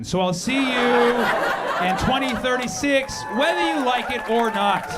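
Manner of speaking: ominous shout to the crowd; mock threat shout. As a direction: bellow it like a ruler who will not be denied.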